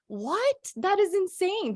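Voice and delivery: high, astonished disbelief; amazed. Real, not acted. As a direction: gasp it out, pitch jumping way up. Amazed at worldwide launch.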